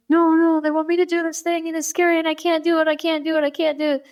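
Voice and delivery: high, breathless-run mock pleading; retold upset child. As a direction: imitate a kid begging off in distress. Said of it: "just really emotionally upset".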